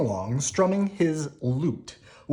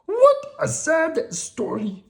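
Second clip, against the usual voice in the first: very high, slow, sympathetic monster voice; Grover voice; 6 dB louder, slower than usual. Acted. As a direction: Grover moved by the story.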